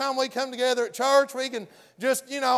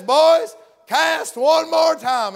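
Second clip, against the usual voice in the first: put-on loud, slow, higher call to the boys; commanding call; 4 semitones higher, 5 dB louder, much slower than usual. Jesus telling the fishermen to cast again, a loud, slow call. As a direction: call it out firmly across the water.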